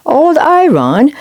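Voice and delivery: high, slow mocking echo; scornful snort. A narrator's reading. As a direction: sneer the name back. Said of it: "they snorted".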